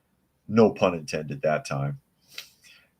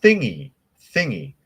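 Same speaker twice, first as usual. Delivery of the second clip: higher-pitched, mock-coy hinting; coy euphemism; 6 semitones higher, 4 dB louder than usual. Sketch Parliament hinting at what to tax, mock-coy. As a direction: say it high, as a silly euphemism. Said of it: "And they were like".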